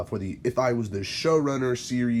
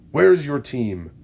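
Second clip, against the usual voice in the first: higher, louder demanding shout; 5 semitones higher, 7 dB louder than usual. A narrator's reading. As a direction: bark the question at him. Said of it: "Red Death shouts".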